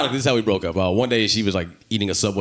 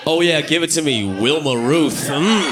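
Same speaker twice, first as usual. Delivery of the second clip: mock passionate lover; 7 semitones higher than usual. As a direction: play a lover moaning a silly name mid-passion. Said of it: "You know how crazy this?".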